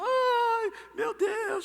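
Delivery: high crying cry of a hired mourner; mock wailing. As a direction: wail it like a paid mourner. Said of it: "elas gritavam, choravam".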